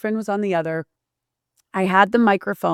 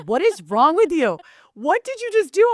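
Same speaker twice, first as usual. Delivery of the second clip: high, sharp scolding friend voice, climbing much higher; mock-scold like a shocked best friend; retelling her friend's scolding, pitched up; 10 semitones higher than usual. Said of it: "she was like".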